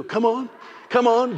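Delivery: ordinary delivery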